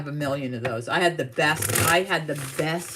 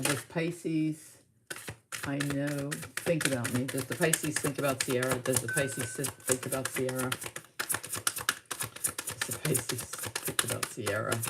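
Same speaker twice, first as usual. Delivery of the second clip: soft, low murmur to oneself; murmured question; 3 semitones lower, 8 dB quieter than usual. Real, not acted. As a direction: murmur it quietly over the cards. Murmuring the question over cards.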